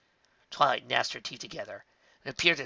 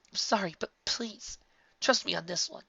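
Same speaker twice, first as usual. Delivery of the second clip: pleading; 8 semitones higher than usual. A narrator's reading. Twilight pleading.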